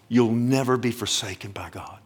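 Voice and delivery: ordinary talk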